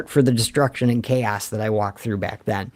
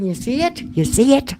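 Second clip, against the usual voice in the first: teasing high prod; 10 semitones higher than usual. Real, not acted. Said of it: "urging viewers to get the pun".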